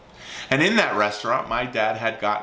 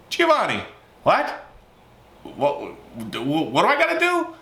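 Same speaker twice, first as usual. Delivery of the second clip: put-on eager young self; 7 semitones higher than usual. Eager son asking about job.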